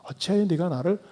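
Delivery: Jesus gently appealing to Saul instead of shouting; pleading appeal. Acted. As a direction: plead softly, like the one who was hurt. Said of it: "마치 호소하는 듯이 말씀을".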